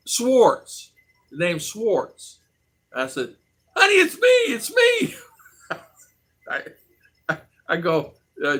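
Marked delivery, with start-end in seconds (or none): 3.8-5.1 high falsetto honey, it's me; imitated eager woman. A stranger at the airport insisting she's his date, high loud calls.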